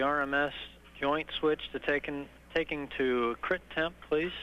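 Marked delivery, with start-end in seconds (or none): none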